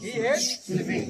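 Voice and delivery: drawn out, with the s of hates clear; slow model sentence. Shown as a demonstration. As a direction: say "hates" slowly for the class. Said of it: "সবাই pronounce কর".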